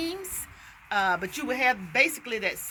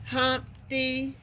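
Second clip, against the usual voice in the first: "Humpty" in two separate beats, higher; split into syllables; 4 semitones higher than usual. Shown as a demonstration. Teacher counting syllables for a child, "Humpty" broken in two. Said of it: "every time you hear a syllable".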